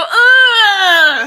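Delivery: high loud strangled ugh; mock glitch grunt. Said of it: "I just heard you go".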